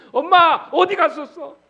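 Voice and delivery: loud, high wail of a hurt son; reproachful child. Acted. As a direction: cry out in accusation at mother, high and loud. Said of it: "엄마를 원망하면서".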